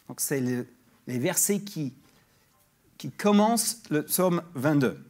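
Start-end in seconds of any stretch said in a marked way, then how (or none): none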